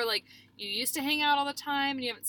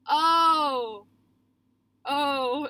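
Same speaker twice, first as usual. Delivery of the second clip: flustered oh; 3 semitones higher, 5 dB louder than usual. Retold or imitated. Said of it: "kind of stumbled away".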